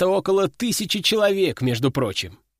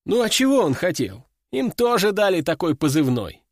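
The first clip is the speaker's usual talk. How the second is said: ordinary delivery, nothing marked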